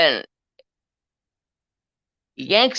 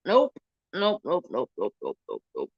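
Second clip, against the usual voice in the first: nopes dropping lower and softer; nope fading; 3 semitones lower, 5 dB quieter than usual. Retold or imitated. Speaker reacting to the adoption news, fading nopes. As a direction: trail off nope by nope. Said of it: "And I was like".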